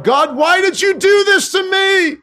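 a mock plea to heaven; crying out to God. Believer crying out to God, high mock plea. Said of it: "you're crying out to God".

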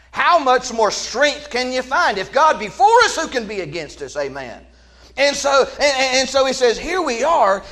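high, loud sermon climax, slowing at the end; preaching at full force. Acted. Preacher proclaiming God as shield, high and loud. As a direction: hammer it home, shouting the last line.

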